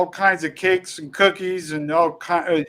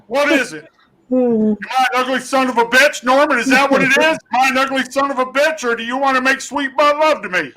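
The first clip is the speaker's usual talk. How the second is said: higher mock drill instructor bark; hammed-up drill instructor tearing into a recruit; imitating a sergeant going off on a recruit; drill instructor yelling; 5 semitones higher than usual. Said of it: "And he goes".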